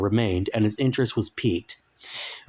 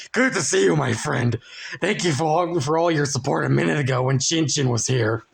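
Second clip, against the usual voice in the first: higher, squeaky little runt; 4 semitones higher than usual. A narrator's reading. The runt thanks Frank, in a higher voice. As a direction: play the tiny runt greeting his friend.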